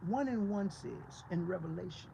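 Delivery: ordinary talk, nothing marked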